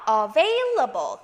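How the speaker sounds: slow "Available" with a buzzing V; voiced V. Shown as a demonstration. Teacher modelling the V sound, slow and voiced. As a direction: say "Available" drawing out the VAIL. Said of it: "성대를 써주셔야 돼요".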